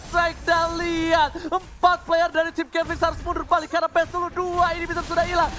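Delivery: loud, high esports hype call; fighting game announcer. Real, not acted. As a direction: shout the push like a caster. (komentator berteriak)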